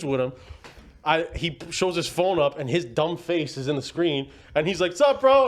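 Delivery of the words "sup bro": high, loud mock bro greeting; imitating a smug friend on FaceTime; cocky greeting. Friend's cheeky greeting on video call.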